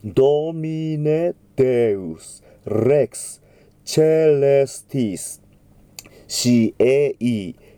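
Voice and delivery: slow, deliberate syllable-by-syllable Latin; careful Latin diction. Shown as a demonstration. Choir teacher modelling Latin text slowly. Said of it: "Dの発音が頻繁に出てきますね".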